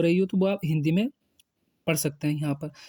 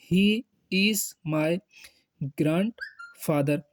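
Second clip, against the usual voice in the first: "He" said alone and lifted, then "is my", then "grandfather" drawn out very slowly; word by word; much slower than usual. Shown as a demonstration. Teacher showing Hindi speakers how to say grandfather, word by word. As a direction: split it up and stretch the last word. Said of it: "Hindi में इसको ऐसे बोलेंगे".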